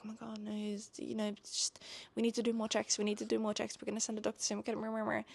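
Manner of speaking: hushed mock-concerned babble; imitated fussing staff. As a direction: mumble worried medical chatter, trailing into nonsense.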